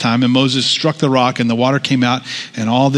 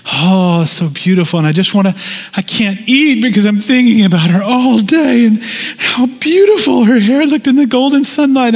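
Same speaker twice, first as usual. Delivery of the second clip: hammed-up lovesick poet, rising higher and louder; mock swooning romantic; 9 semitones higher, 3 dB louder than usual. Preacher mocking romantic-era writers with a lovesick gush. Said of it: "and it was".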